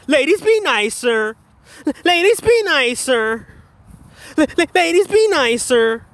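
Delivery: drawn-out, high mocking plea; exaggerated imitation of someone begging. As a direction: stutter into it, then stretch it out mockingly. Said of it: "Begging them and shit to be nice".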